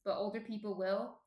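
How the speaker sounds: ordinary talk, nothing marked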